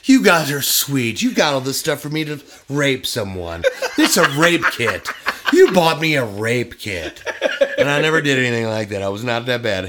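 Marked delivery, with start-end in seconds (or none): 0.0-7.2 loud, laughing fake thanks; laughing mock gratitude. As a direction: gush through laughter, mock-sweet. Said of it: "It's like".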